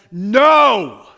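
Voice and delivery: a loud, high shouted no; emphatic no. Acted. Paul refusing outright, shouted loud and high. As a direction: shout it down hard. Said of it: "As emphatically he".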